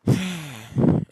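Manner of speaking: big exasperated sigh, loud. Real, not acted. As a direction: heave a loud fed-up sigh. Frustrated at opponent's move.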